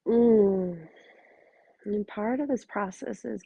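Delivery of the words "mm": long, contented "mm"; real, lingering hum as the feeling settles; savouring hum. Real, not acted. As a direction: let out a drawn-out hum of satisfaction. Savouring celebration in her body.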